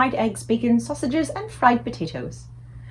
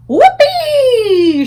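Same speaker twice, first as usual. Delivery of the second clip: much higher, loud whooping shout; giant's whoop; 11 dB louder than usual. A narrator's reading. The BFG whooping after his whiz-popper, high and loud. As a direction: whoop with glee. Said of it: "shouted the B F G".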